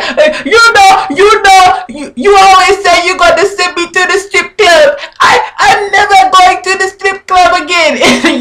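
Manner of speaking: mocking high Jamaican-style accent, mouth full of bread; Jocelyn's accent. Vlogger mocks Jocelyn's accent for viewers, high muffled impression. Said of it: "dying at this woman's accent".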